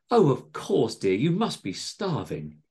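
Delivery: high, fussing sympathy; doting landlady. A narrator's reading. Missus Tingleberry fussing over hungry John, doting. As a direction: dote on a hungry favourite. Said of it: "said Missus Tingleberry".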